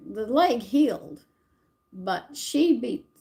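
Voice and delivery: ordinary talk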